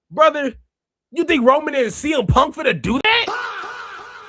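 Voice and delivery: high, loud, laughing disbelief; laughing, mocking disbelief; laughing shout. Real, not acted. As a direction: laugh it out loud, incredulous, at a far-fetched idea. Host laughing at chat idea.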